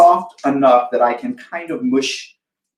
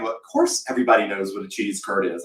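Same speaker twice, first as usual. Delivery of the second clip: lower, softer, matter-of-fact; mock know-it-all; 5 semitones lower, 6 dB quieter, faster than usual. Wisconsin friends teasing her, low, smug tone. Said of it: "So you're all going".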